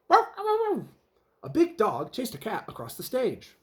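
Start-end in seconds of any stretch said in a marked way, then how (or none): 0.1-0.9 very high, loud bow-wow barking; a dog yapping after the cat. Acted. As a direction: bark like a chasing dog. Dog barks chasing cat.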